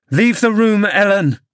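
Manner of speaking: high-pitched furious order; overwrought, high-voiced dismissal; agitated command. A narrator's reading. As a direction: order her out, trembling with anger. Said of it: "trembling all over".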